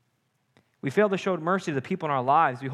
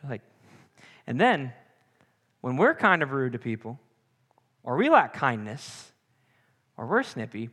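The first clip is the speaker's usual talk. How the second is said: ordinary delivery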